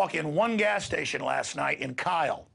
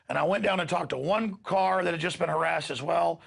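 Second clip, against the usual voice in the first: normal talking voice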